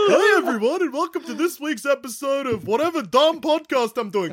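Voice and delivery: very high, cartoonish send-up of a podcaster; mock a chirpy show intro way up high; Jackson Bailey. Host parodies co-host opening his podcast, high cartoon voice. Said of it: "is that you doing my voice?".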